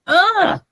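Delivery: high frustrated groan of disgust; a fed-up high ugh; exasperated ugh. Real, not acted. Ending a rant to Julie, high exasperated groan.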